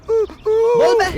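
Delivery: high, drawn-out angry yell; bully the answer out of him, dragging the words; angry demand. Acted. Interrogator to the captive he is beating, angry demand.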